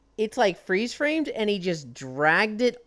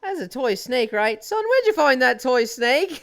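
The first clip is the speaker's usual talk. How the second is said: high, louder mock-alarmed dad; 8 semitones higher, 6 dB louder than usual. Dad asking son about the snake, mock alarm. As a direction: imitate a dad's rising alarm.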